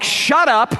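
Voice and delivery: high mock snap at wife; lightly voiced husband barking; rude shut up. Driver silences his wife, high mock snap. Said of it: "He's like".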